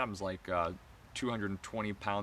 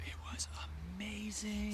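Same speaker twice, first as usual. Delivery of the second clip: high, hushed whisper; awed whisper; 12 semitones higher, 7 dB quieter than usual. Real, not acted. One host gushing about the silent disco, awed whisper. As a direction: whisper it in wonder.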